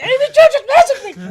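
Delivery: very high, loud fangirl squeal; excited shriek. Retold or imitated. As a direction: shriek it fast at full pitch, half laughing. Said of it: "how high I shrieked".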